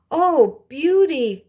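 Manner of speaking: put-on high, loud wail of the older sisters; sisters cry out. A narrator's reading. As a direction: cry her name out high and loud in dismay. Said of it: "cried the older sisters".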